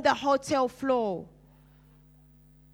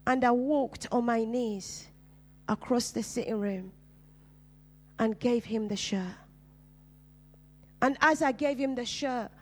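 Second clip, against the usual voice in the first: ordinary delivery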